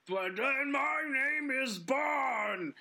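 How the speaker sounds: slow, high, shouted villain boast; villain yell. Retold or imitated. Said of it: "like, yelling".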